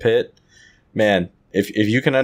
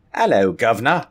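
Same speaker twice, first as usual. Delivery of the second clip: put-on Cockney cheer, rising at the end; mock Cockney greeting. American co-host teasing his British partner with a greeting, mock Cockney. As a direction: do a jokey London Cockney accent. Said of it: "I'll do it, uh, for, for where you live at".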